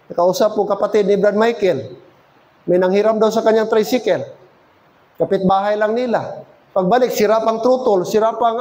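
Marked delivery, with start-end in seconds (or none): none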